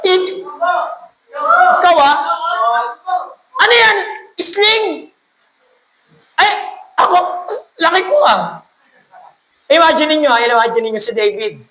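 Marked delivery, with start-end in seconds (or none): 0.0-8.6 mocking giant. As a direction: jeer at the tiny boy like a giant. Goliath mocking David. (nanunuyang higante, matinis at malakas)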